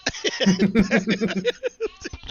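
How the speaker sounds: bursting high laugh; real laugh at the story; loud laugh. Real, not acted. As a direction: crack up loudly mid-story. Laughing at her own story.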